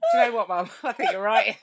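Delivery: laughing through the words; giggling as she answers. Real, not acted. Laughing while agreeing.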